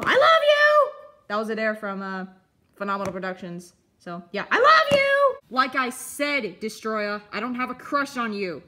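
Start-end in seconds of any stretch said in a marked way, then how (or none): 0.0-0.9 high squealing love call; high, loud lovestruck squeal; gushing shout. Acted. Kaiju yells love at crush. 4.0-5.4 high squealing love call; high, loud lovestruck squeal; gushing shout. Acted.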